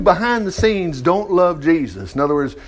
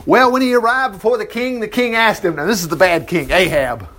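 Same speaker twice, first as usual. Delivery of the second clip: normal talking voice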